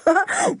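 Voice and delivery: loud, high hearty laugh. A narrator's reading. Julian laughs loudly to reassure Anne, hearty laugh. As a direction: laugh off the scary tale. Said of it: "He laughed loudly".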